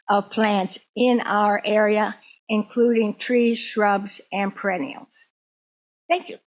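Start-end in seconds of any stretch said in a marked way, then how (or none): none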